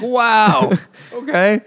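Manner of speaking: a put-on bewildered reply; stunned reaction. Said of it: "They're like".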